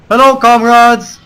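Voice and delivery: loud, high, drawn-out greeting; hailing comrades. Pretend. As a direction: call out grandly across the battlefield. Calling out to allies in game.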